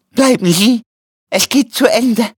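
high, strained plea of a dying man; sterbend flehend. A narrator's reading. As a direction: beg weakly from high in the throat. Dying man begs helper to stay. (hoch und gequält flehend)

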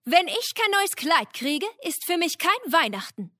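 high, vain peacock. Acted. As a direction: preen it like a showy peacock. Pfau spricht.

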